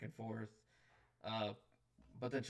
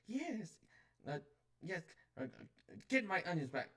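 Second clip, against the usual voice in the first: higher, eager little gnome woman; gnomish woman; 7 semitones higher, 4 dB louder, faster than usual. Acted. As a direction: pipe up like a fussy shopkeeper gnome.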